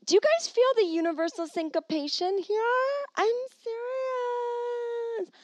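high, stretched-out wailing insistence; hammed-up high cry, trailing off; mock-emotional wail. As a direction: wail it out, dragging "serious" into a long squeal. Mock amazement at coincidence.

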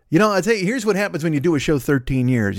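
normal talking voice

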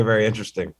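normal talking voice

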